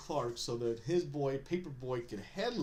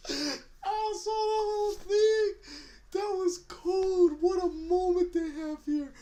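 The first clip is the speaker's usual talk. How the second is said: very high, loud squeal of amusement; real helpless high-pitched delight; cracking up; 7 dB louder, slower than usual. Real, not acted. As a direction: squeal it out slowly, overcome with laughter. Cracks up retelling a favourite moment.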